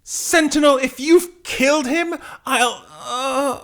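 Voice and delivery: high, anguished heroine fading out; distraught threat. Acted. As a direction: threaten tearfully, then pass out mid-sentence. Jean threatens, then is gassed.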